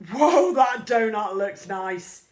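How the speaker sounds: loud, slow, awed classmate voice; admiring classmate. A narrator's reading. Another classmate, slow and loud, admiring the donut. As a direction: drawl it out in awe.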